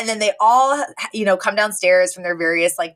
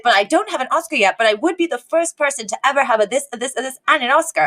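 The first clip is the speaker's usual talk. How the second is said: put-on childish listing; 6 semitones higher, faster than usual. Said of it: "he's like".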